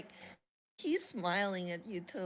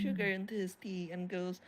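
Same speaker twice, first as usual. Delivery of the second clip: usual speaking voice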